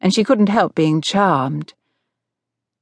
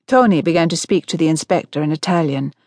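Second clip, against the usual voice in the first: ordinary delivery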